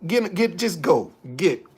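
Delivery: normal talking voice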